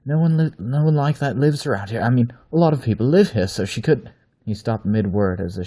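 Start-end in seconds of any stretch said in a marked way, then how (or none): 0.0-4.1 panicked, loud and high; words tumbling out in fear; frightened rambling. Acted. Captive man pleading under threat.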